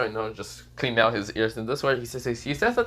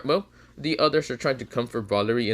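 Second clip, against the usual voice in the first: plain reading voice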